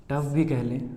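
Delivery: ordinary talk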